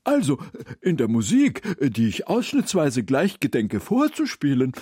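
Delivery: Herr Mommsen als Figur. A narrator's reading. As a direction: lecture pompously and excitedly in convoluted phrases. (umständlich begeisterte, erhöhte Figurenstimme)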